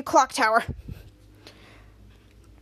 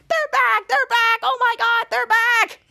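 high, loud alarm, acted out; panicked outcry; 8 semitones higher, 4 dB louder, slower than usual. Boy spotting the Hornets, high panicked shouting. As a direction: cry out as if spotting danger.

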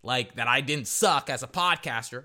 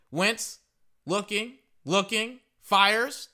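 live play-by-play; 7 semitones higher, much slower than usual. Real, not acted.

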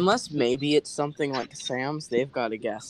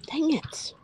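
frustrated whisper. Real, not acted. Losing a life in game.

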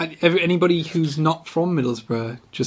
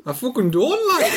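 higher, laughing stab at Geordie; mock Geordie; 7 semitones higher than usual. Friend failing at Geordie, laughing mock accent. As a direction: try Geordie, giggling. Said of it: "How do Geordies talk?".

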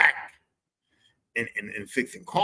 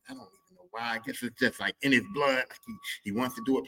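ordinary talk

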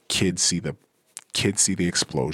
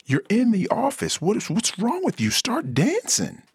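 very high, incredulous demand; very high mock-outraged office voice; outraged coworker. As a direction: shriek at him to start dancing. Improv character berating.

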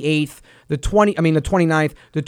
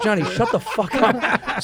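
laughing retort; 5 dB quieter, slower than usual. Retold or imitated. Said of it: "And I'm like".

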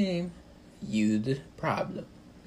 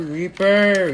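name spat out louder and higher; angry yell; 6 semitones higher, 9 dB louder than usual. Real, not acted. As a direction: curse the enemy hero loudly. Cursing an enemy in game.

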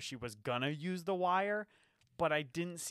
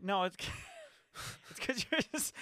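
laughing; 11 semitones higher than usual. Real, not acted. Laughing while speaking.

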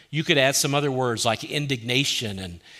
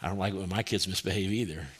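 quick line with a light chuckle; chuckling; 5 semitones lower, 5 dB quieter, faster than usual. Acted. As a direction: laugh a little as you say it. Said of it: "God chuckles a little".

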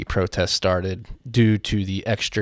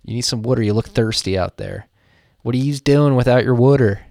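put-on raised, loud South Philly accent; South Philly grandma; 4 semitones higher, 6 dB louder than usual. Philly grandma fussing over someone thirsty, South Philly accent. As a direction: nag in a South Philly accent, water as "wooder". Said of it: "not South Philly grandmas".

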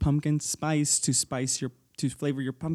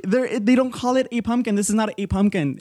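heated; 6 semitones higher, 4 dB louder than usual. Real, not acted. Heated pumpkin argument.

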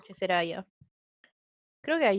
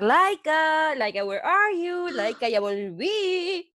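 drawn-out singsong call for a dog; excited mock yell, words stretched out; retold calling out; 6 semitones higher, much slower than usual. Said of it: "empecé a gritar".

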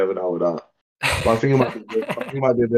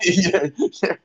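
talking through real laughter, pitched up; laughing; 7 semitones higher than usual. Real, not acted. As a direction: crack up while trying to speak. Cracking up at the joke.

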